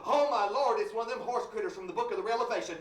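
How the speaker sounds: loud, fast panicked cry; terrified preacher. Acted. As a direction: blurt it out in terror at the monster.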